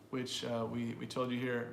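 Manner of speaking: normal talking voice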